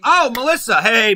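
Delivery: light up as you recognise her; surprised recognition. Real, not acted. Recognising a chat viewer.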